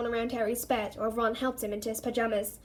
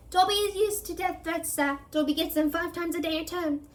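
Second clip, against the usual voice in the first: high, meek little elf; house elf voice; 6 semitones higher than usual. A narrator's reading. The elf answers the threat, high and meek. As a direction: answer meekly, higher.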